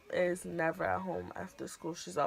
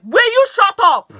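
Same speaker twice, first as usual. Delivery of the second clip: high, loud snapped shut up; mock dad yell; 14 semitones higher, 15 dB louder than usual. Dad shouting at daughter in car, loud mock yell. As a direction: snap it like a fed-up father.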